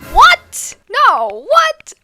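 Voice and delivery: very high, loud shocked yelps; hammed-up shriek of disbelief; retold shock. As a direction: squeal in exaggerated disbelief. Retold shock at tornado news.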